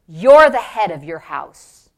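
low, slow, firm pronouncement; deep, emphatic divine verdict; the Lord's voice. Retold or imitated. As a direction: state it with slow authority. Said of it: "And the Lord said".

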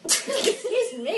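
laughing right after a sneeze; high, laughing apology after sneezing. Real, not acted. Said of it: "Excuse me, I'm gonna sneeze".

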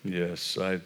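low, hushed, ominous pronouncement; spooky discerner voice. Retold or imitated. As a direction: intone it like an eerie mystic. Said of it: "like it is some sort of".

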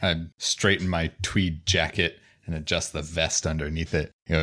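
normal talking voice

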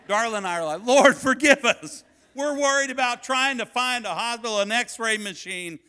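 shouted, impassioned pulpit delivery; full-force preaching. Real, not acted. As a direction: cry it out to the congregation.

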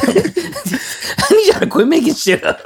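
high, slow through laughter; laughing at friends' made-up claims; laughing protest. Real, not acted. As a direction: plead to stop while laughing.

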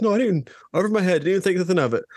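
high, fast, breezy reassurance; quick reassuring brush-off. Retold or imitated. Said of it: "He goes".

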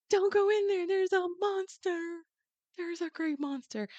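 high, panicked warning; hammed-up panic about a monster; a girl fleeing the palace, warning her family; freaked out. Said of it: "freaking out".

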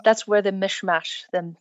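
plain reading voice

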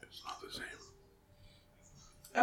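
very slow, barely-there whisper; whispered complaint. Real, not acted. Co-host pining for sloppy joes, drawn-out whisper. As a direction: whisper it slowly, almost inaudibly. Repeats own line, now whispered.

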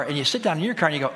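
ordinary talk

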